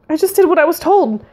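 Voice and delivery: high-pitched, defensive blurt; in a rush. A narrator's reading. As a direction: blurt out the excuse. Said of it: "she said in a rush".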